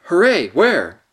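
loud, eager hooray; a boy's loud burst of delight; excited cry. A narrator's reading. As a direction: leap up with joy. Edmund jumping up excited.

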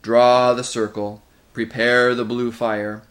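slow, loud, ominous chant-like commands; dreadful creature summoning with force; gray, terrible voice. A narrator's reading. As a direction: intone each order heavily, as for a dark rite. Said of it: "said the gray voice".

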